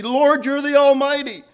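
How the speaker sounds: high, fervent prayer; impassioned plea for mercy, pitched high; crying out. Acted. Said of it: "Cry out for his mercy".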